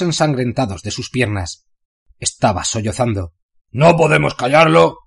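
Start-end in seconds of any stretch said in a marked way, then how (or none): 3.7-5.0 annoyed complaint. Acted. Trog complains.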